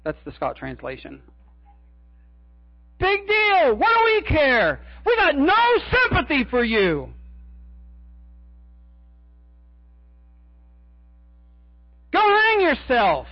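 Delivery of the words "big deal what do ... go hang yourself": mock him like contemptuous priests; scornful priests. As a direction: chief priests sneering at Judas. Chief priests mocking Judas.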